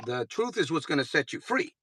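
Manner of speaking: ordinary talk, nothing marked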